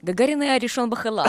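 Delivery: Hebrew with a put-on Russian accent. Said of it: "אז תראי לי איך עושים מבטא רוסי". (מבטא רוסי בעברית)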